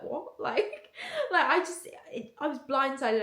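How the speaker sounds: laughing through the words, voice jumping up; giggly and loud, still amazed by the news; real laugh breaking into the story; laughing in disbelief. Real, not acted. Laughing over the shock.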